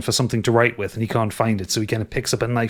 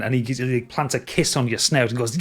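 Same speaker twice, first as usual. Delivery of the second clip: usual speaking voice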